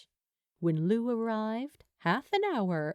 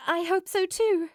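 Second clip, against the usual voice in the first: high, loud, eager; bursting with hope; eager reply; 9 semitones higher, 6 dB louder than usual. A narrator's reading. Steed calling after the departing bartender, eager and high.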